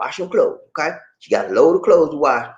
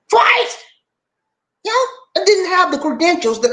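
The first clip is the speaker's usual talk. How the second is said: indignant; 13 semitones higher than usual. Real, not acted. Outraged at being passed over.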